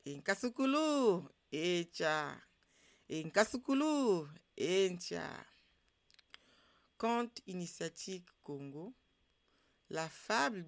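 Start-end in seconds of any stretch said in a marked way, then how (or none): 0.1-5.5 storyteller's opening call. Acted. Storytelling opening call and response formula. (formule d'ouverture de conte, lancée fort)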